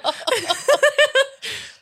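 high, loud cackling laugh; long high peal of laughter; big laugh. Real, not acted. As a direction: burst out laughing at your own teasing. Laughs at own mockery.

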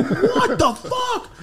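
very high, drawn-out squeal; squeaky slow-motion shock; stunned disbelief. Retold or imitated. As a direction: stretch it out high, laughing in astonishment. Retold shock at growing taller.

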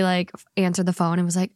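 usual speaking voice

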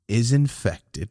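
slow, drawn-out imitation of a diagnosis; doctor's grave pronouncement. Doctor telling patient about the ear, slow imitated pronouncement. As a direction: stretch out INFECTED like an alarmed doctor. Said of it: "That's how he said it".

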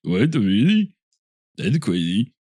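low, drawled celebrity voice; a drawling tech-billionaire voice; Elon Musk impression. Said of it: "That's Elon's impression".

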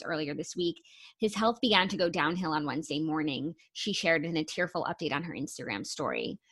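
soft, subdued news read. Acted. Co-host reading sad celebrity news to listener, subdued. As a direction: read the sad headline gently. Reads news story aloud.

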